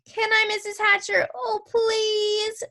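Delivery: high, drawn-out pleading; begging girl. A narrator's reading. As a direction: beg a grown-up like an eager kid. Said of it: "begged some more".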